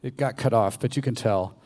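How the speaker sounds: ordinary talk, nothing marked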